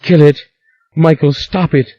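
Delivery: frantic scream at her husband. A narrator's reading. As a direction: scream the command in terror. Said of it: "screamed Rowan".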